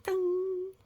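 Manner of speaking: high sung ding sound effect; ping it out like a bell; playful high ding. Pretend. Sound effect in story reading.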